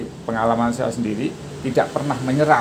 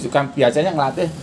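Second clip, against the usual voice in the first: normal talking voice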